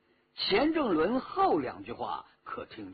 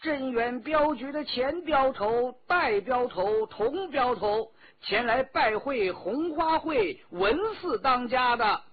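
high, loud formal call from outside; ringing high-pitched herald's announcement; 8 semitones higher, 5 dB louder than usual. A narrator's reading. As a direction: call out the visitors' names, drawn out. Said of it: "大声地说".

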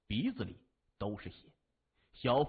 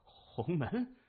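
startled, fearful question, pitched higher; trembling question; 6 semitones higher than usual. A narrator's reading. Cornered gang leader hears who his attackers are, trembling. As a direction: ask it in mounting dread. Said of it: "他颤声问着".

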